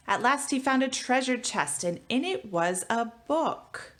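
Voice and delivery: lively picture-book read-aloud; reading to children. Acted. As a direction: read it to a class of kids, rhyming and bouncy. Teacher reads picture book aloud.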